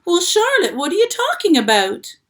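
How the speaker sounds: high, loud alarmed pig voice. A narrator's reading. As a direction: plead in shock. Wilbur alarmed.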